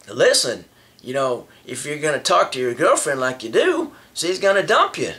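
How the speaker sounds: usual speaking voice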